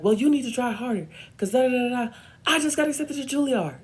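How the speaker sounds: high, loud, fast retort; escalating argument. Retold or imitated. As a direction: build the quarrel into a shouted announcement. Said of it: "I start an argument with her".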